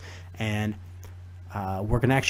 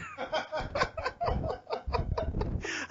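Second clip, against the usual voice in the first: breathy high wheeze of a laugh; high unvoiced laugh; 10 dB quieter than usual. Real, not acted. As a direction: let a silent high laugh escape. Laughing at the story.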